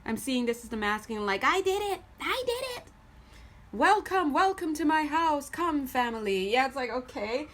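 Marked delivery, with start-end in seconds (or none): 1.4-6.5 triumphant. Retold or imitated. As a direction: crow with pride, arms wide. Masculine figure proudly welcoming home.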